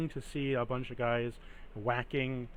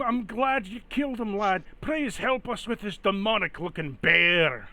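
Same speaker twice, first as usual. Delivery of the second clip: lightly higher villager plea; hint of a villager; 6 semitones higher, 4 dB louder than usual. Villager asks Gunther to fight the bear, lightly higher plea. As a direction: beg the hero for help, a little raised.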